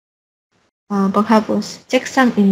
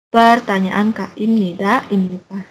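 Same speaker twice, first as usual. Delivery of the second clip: usual speaking voice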